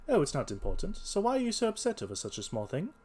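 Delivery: high, light detective questioning; casual detective. Acted. Holmes soothes the salesman.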